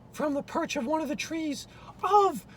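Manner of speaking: usual speaking voice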